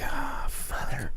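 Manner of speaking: slow soft whispered dread; whispered curse. Retold or imitated. Seeing the rude customer, whispered dismay. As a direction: mutter it under your breath.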